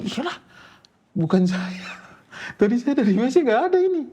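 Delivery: very high-pitched, acted-out denial; retold protest. Sibling denies leaving blood in the toilet, high retold protest. Acted reply to sister's accusation.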